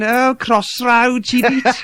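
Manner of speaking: high, drawn-out mock posh accent; posh southern accent. Teasing the posh friends in Bournemouth or Brighton, mock posh accent. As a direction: mimic posh southern friends, pitched right up and slow. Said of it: "my posh friends down".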